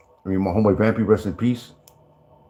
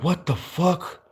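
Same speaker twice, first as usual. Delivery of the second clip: slow, drawn-out, much higher what the fuck; stunned disbelief; 7 semitones higher, 4 dB quieter, much slower than usual. Retold or imitated. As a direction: drag it out in shock, pitched well up. Stunned reply to the cop.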